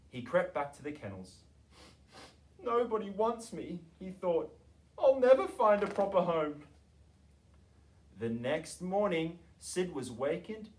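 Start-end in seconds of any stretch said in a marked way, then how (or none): 2.6-6.6 higher, wailing little dog; lonely and pitiful; sad puppy voice. A narrator's reading. Lonely dog thinking sadly.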